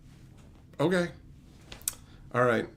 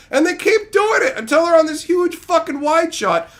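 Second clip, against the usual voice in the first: higher, louder fed-up yell; exasperated shout; 10 semitones higher, 6 dB louder than usual. Real, not acted. Streamer shouting at the mountain-climbing scenes, exasperated yell. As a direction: yell it like you're fed up with the film. Ranting about endless mountain shots.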